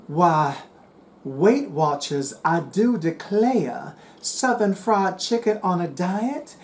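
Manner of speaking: put-on slow, drawn-out Southern belle accent; put-on Southern drawl, breaking into a laugh at the end. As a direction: lay on a playful Southern accent, drawling each phrase. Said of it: "that's the extent of my southern accent".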